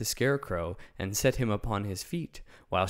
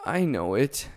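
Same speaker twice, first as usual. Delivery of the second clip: ashamed; 4 semitones higher, 5 dB louder than usual. A narrator's reading. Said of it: "hanging his head in shame".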